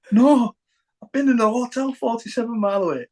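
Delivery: protesting shout. Retold or imitated.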